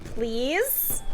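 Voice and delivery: normal talking voice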